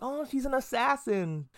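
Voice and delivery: high, slow dawning realization; the penny drops, softly. Retold or imitated. Viewer remembering Yor's job, slow realizing tone. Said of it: "And I was like".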